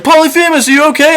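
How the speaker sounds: high calling voice checking on a friend; worried friends. Retold or imitated. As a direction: call up high outside the cave.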